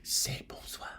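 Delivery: whispered prompt. Retold or imitated. Nudging Carol at the shop door, whispered. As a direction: hiss it into her ear as a nudge. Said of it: "I'd elbow her, go".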